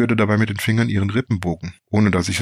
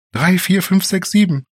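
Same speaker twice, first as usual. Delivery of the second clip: high, loud frantic counting; hectic calling out; 11 semitones higher, 5 dB louder than usual. Acted. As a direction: blurt the numbers out in a rush. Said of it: "rief sie hektisch".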